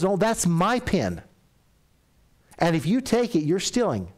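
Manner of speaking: firm scolding. Retold or imitated. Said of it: "She goes".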